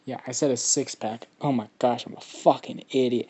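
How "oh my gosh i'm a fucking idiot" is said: usual speaking voice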